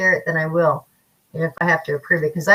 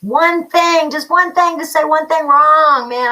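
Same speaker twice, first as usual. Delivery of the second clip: imitating her own vengeful inner voice; mock worked-up rant; 8 semitones higher, 6 dB louder than usual. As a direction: ham up the head's angry what-if. Voicing spiraling what-if thoughts.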